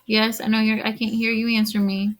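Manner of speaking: ordinary talk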